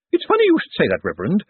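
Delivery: very high, eager nervous opening; nervous lady. A narrator's reading. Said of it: "her eyes bright with nervousness".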